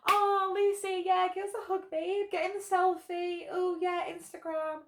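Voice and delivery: mock-gushing Instagram girl; gushing greeting. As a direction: overdo the sweet best-friend act. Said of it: "greeting this girl warmly, like".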